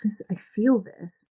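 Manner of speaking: slow whisper, as if moved by a love story; breathy whisper. Retold or imitated. Reader caught up in a romance, whispering slowly. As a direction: hush it, feeling the moment slowly. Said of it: "that makes you go".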